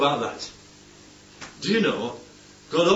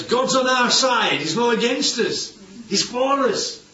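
triumphant declaration; 8 semitones higher than usual. Real, not acted. Preacher's triumphant point.

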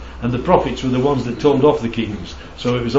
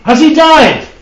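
much higher and louder, a mock alarm; alarmed question; 11 semitones higher, 6 dB louder, slower than usual. Bystander in the joke guessing the old rabbi died, mock alarm. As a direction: gasp it out as the penny drops. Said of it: "He said".

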